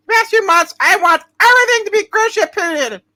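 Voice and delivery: normal talking voice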